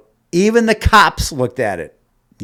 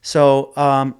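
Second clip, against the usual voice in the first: ordinary delivery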